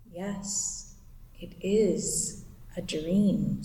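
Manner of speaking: low, slow, lulling witch. Acted. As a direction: lull them slowly and darkly. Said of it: "said the witch".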